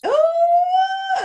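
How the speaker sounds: long, high, loud "ooh"; drawn-out high squeal of approval; excited whoop. Real, not acted. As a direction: let out a soaring whoop of delight. Delighted reaction to the word holla.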